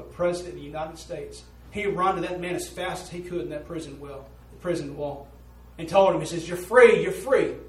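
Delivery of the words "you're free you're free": shouting with joy; excited news. Retold or imitated. Preacher acts excited messenger.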